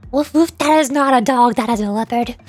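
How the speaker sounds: normal talking voice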